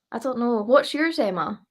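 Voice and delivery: usual speaking voice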